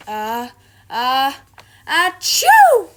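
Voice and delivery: loud building sneeze; big sneeze. Acted. Douglas tickled by fleece, loud sneeze.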